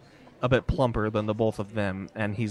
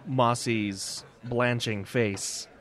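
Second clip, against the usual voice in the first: ordinary talk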